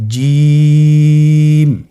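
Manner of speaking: জিম held long and loud; drawn-out Jim. Shown as a demonstration. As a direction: stretch জিম with full voice. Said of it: "আপনারা একটু লক্ষ্য করুন". (তিন আলিফ টেনে জিম)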